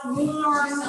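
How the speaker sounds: "warm" drawn out Southern style, like "worm" with a long o; Southern pronunciation of "warm". Shown as a demonstration. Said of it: "pronouncing it the way people in the South".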